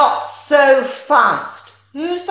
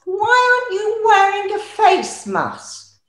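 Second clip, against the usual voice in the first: shrill camp pantomime dame; 9 semitones higher than usual. Acted. Dame character questions the newcomer.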